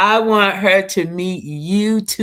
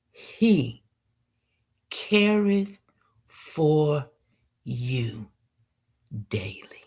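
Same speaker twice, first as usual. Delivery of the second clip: slow, hushed poetry reading; poem recitation; much slower than usual. Acted. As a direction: recite each word with pauses.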